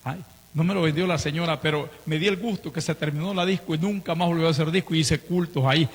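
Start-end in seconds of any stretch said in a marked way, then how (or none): none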